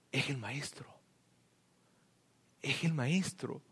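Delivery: breathless whisper, very soft; awed whisper. Retold or imitated. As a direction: whisper it as if you can't believe your eyes. Juan recognizes Jesus in awe.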